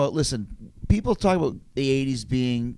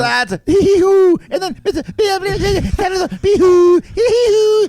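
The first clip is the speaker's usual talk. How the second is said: silly Adam Sandler voice; 9 dB louder, slower than usual. Retold or imitated.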